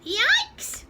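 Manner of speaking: a startled fox yelping; fox's yikes. Acted. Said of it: "said the fox".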